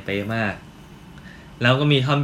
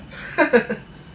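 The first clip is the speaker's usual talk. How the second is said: amused laugh; 14 semitones higher than usual. Real, not acted. Reacts to the word shorty.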